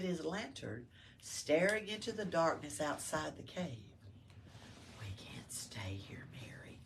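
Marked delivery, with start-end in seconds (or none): 5.0-6.8 hushed, breathless warning; soft whisper. Acted. Joseph warns Mary in the cave, whispering. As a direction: whisper it so no one hears. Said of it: "he said in a soft voice".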